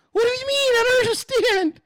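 very high, mock-bewildered squeal; exaggerated falsetto complaint; whiny confused protest. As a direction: wail it high and loud, playing baffled. Acting out her confused protest.

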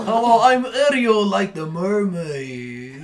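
mock friend's voice, stretching the last phrase; Ariel. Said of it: "you have to sound like Ariel".

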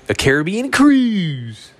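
high, drawn-out squeal on cruise; mock excitement. Comic telling of a trip at nineteen, mock excitement. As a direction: stretch it out high in showy delight.

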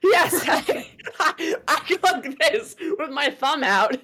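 laughing high and loud through the words. Real, not acted. Friend laughs while confessing the fake Six Flags photo, laughing high.

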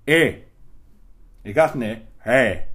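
loud garbled mock Irish accent; short blurts in thick Irish accent; strong Irish accent. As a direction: imitate a barely intelligible Irish accent. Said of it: "I can't understand your accent".

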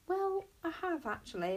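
a small confession; admitting. A narrator's reading. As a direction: own up to it. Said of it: "admitted Bodkin".

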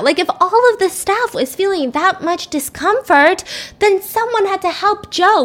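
rising righteous enthusiasm; fired up. Real, not acted. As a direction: talk yourself into a mission. Fired-up rant about curing Joe.

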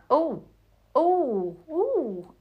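high "oh, oh, ooh" swooping up in delight; a teasing, thrilled gasp at each reveal; rising surprise. Retold or imitated. A viewer seeing the second cameo, swooping delighted surprise. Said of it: "the second one is like".

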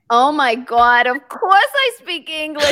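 loud, high exclamation; hammed-up, high-pitched indignation; outraged mock outburst. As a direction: exclaim it high and over the top. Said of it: "And I went like this. I went".

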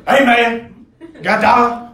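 lightly voiced homeless guy begging; a hint of a pushy panhandler; homeless man asking. Homeless man pops up asking comedian for a dollar, lightly voiced.

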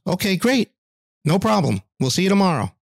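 a bakery clerk taking an order on the phone, upbeat; cheery bakery clerk. Retold or imitated. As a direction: chirp it like a friendly shop clerk on the line.

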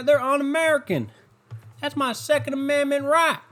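high, put-on voice of a ranting gun-rights guy; mock angry gun nut. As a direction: play the outraged gun nut who won't shop there. Mock redneck gun-rights rant.

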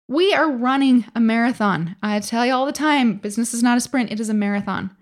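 ordinary delivery

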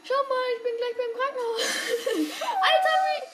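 high, loud laugh through words. Real, not acted. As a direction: laugh while talking. Laughing at the game.

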